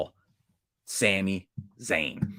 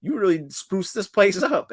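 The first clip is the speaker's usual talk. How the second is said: impressed wrestlers; 9 semitones higher than usual. Retold or imitated. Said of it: "and they go".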